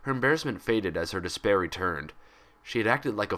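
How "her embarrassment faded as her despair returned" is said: usual speaking voice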